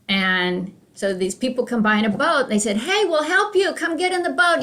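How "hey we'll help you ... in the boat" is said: high-pitched, friendly hail; rescuer calling out. Acted. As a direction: call up to someone stranded on a roof. Rescuers calling to man on roof.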